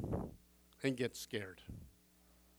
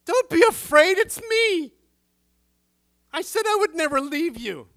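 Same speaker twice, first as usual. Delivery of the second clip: much higher and louder, a bright reassuring call across the water; Jesus calling out; 12 semitones higher, 12 dB louder than usual. Acted. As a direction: shout comfort over the storm. Jesus speaks to disciples in storm.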